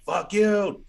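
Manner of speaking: put-on high loud Boston accent Fuck you; Boston accent yell. Robber's last defiance at police in thick Boston accent. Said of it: "one more, like".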